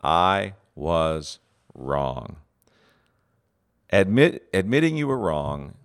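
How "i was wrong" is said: very slow, each word weighted, stress on I; slow deliberate admission. Shown as a demonstration. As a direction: own it slowly and plainly. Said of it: "really difficult word for leaders to speak".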